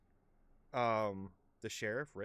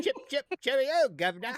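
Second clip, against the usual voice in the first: high, loud hammed-up British; terrible fake British accent; bad British accent; 13 semitones higher, 8 dB louder than usual. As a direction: overdo the posh Brit. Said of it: "we can't do a British accent".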